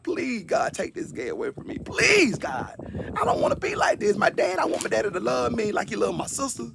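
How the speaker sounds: swinging between high cries and whispered begging; desperate pleading prayer. Retold or imitated. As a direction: plead with God like a hurt child. Retold childhood prayer, pleading.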